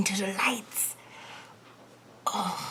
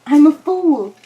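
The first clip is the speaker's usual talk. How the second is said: louder, higher self-mockery; exasperated outburst; 5 semitones higher, 12 dB louder than usual. Real, not acted. Streamer realizes the cable was unplugged, loud exasperation. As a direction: blurt it out at yourself.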